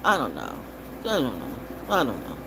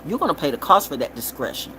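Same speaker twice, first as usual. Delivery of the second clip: ordinary delivery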